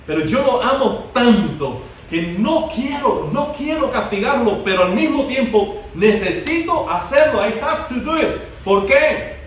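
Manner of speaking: rising, urgent sermon voice; fiery preaching. Acted. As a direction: preach it out to the whole church. Preacher builds to full force.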